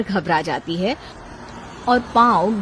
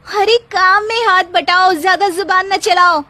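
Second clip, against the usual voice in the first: mock scolding; 7 semitones higher, 4 dB louder than usual. Wife threatening husband playfully.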